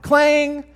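high, ringing imitated clang; loud clang. Preacher mimicking the knife hitting the plate, loud clang. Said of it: "this very loud clang sound".

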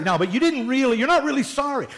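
high-pitched parental nagging, lightly acted; hammed-up accusing tone; mock scolding. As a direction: argue back like an unconvinced parent.

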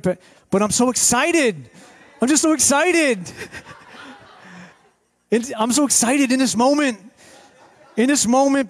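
lightly mimicked pastor, high and eager; pastor impersonation. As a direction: gush like an over-excited pastor. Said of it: "should I do an impersonation of, of Pastor?".

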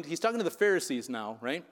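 usual speaking voice

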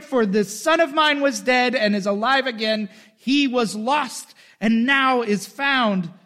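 a father calling out in delight; father overjoyed, ordering the servants; joyful father. Acted. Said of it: "But the father said to his slaves".

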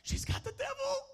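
very high comic squeak of alarm; alarmed squeal. Acted. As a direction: tattle to God in a high-pitched voice. Old man tattles to God.

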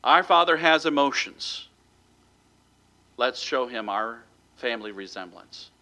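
usual speaking voice

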